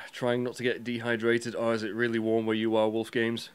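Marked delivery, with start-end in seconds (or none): none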